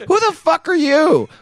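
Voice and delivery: high sharp indignant question. Retold or imitated. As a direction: demand it, startled. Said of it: "Snake's reaction".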